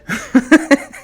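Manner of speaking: loud, high burst of laughter; high cackling laugh; incredulous laugh. Real, not acted. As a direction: crack up in disbelief. Laughs at the false family claim.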